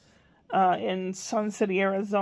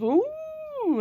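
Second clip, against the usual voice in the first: put-on high drawn-out ooh of a little boy; a three-year-old's wide-eyed ooh; child's impressed ooh. Imitating grandson seeing ice cream, high ooh. Spencer's reaction voiced.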